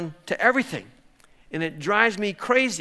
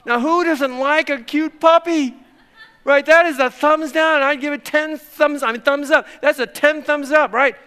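high, loud teasing protest; mock indignation; 7 semitones higher, 7 dB louder than usual. Pastor teasing thumbs-down voters on puppy videos, laughing mock indignation. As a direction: laugh it off in comic outrage. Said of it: "I'm kind of making fun of this".

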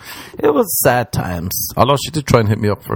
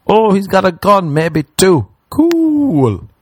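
high, louder mock glee; mock excited; 10 semitones higher, 4 dB louder, slower than usual. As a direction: ham up the fake enthusiasm. Mock voice of the cop scene.

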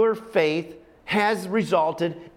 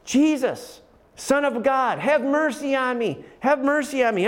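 calling out; 7 semitones higher than usual. Retold or imitated. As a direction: call out desperately to Jesus. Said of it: "And he's screaming".

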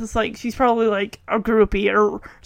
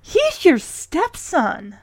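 mock-shocked friend; 4 semitones higher, slower than usual.

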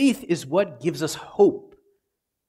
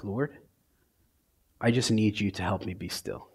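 soft, hushed plea; quiet prayer; 5 semitones lower, 8 dB quieter than usual. Retold or imitated. Praying alone at night for stillness, hushed. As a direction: pray it under your breath. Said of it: "I have to just say to myself".

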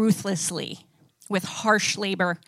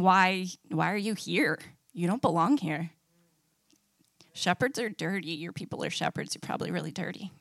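sneering contempt; 4 semitones lower, 8 dB quieter than usual. Acted. Egyptians sneering at Moses.

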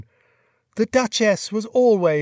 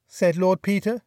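ordinary delivery, nothing marked

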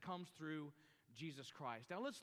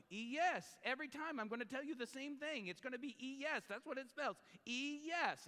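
higher, insistent mock exasperation; growing frustration; 6 semitones higher than usual. Father repeating his answer to his giggling daughter, increasingly frustrated. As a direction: imitate an exasperated dad, pitched up and emphatic. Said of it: "his frustration grows and grows and grows".

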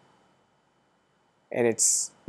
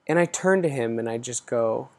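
normal talking voice

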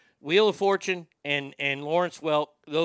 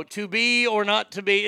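loud, raised puzzle answer; calling out answer; 5 semitones higher, 5 dB louder than usual. Retold or imitated. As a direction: call it out like a contestant. Calls out solved puzzle answers.

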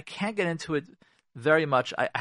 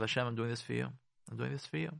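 much softer, a private vow; quiet inner prayer; 3 semitones lower, 11 dB quieter, faster than usual. Retold or imitated. Spouse letting go for Hashem, softly to herself. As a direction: murmur it as a prayer to yourself. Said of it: "thinking to yourself".

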